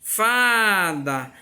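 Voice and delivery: fada with a long, held "fa"; stressed first syllable. Shown as a demonstration. Teacher repeating fada for pupils, drawn-out "fa". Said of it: "o fá eu falo com mais intensidade".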